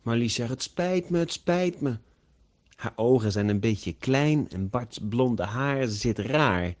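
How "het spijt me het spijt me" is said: high-pitched repeated apology; apologetic. A narrator's reading. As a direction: say sorry in a small high voice. Marlies apologizes on arrival.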